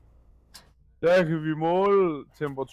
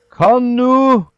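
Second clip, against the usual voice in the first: loud, high urging call; urgent plea; 8 semitones higher, 11 dB louder than usual. Real, not acted. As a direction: push her on, loud and high. Urging the sick dog on.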